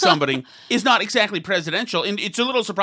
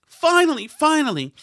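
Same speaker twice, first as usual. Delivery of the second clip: mocking relief; 7 semitones higher than usual. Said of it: "People are saying".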